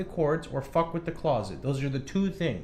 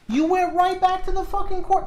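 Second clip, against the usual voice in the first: much higher, louder outburst at the cat; exasperated yell; 5 dB louder than usual. Real, not acted. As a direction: shout at the cat in disbelief. Cat returns to cords.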